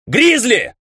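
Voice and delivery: high-pitched urgent call; shout. A narrator's reading. Ray calls to his friend over the abyss, shouting. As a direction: cry out to a friend, voice leaping up high. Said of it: "закричал он".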